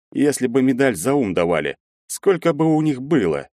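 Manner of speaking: musing. A narrator's reading. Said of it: "задумчиво спросил Бартынов".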